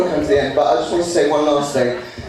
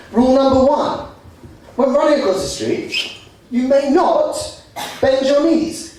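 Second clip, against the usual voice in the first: mock rules; 8 semitones higher than usual. Mock rulebook recitation.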